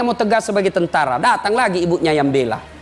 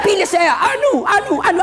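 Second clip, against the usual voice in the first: mock campaign plea; 12 semitones higher than usual. Candidate begging, said again laughing.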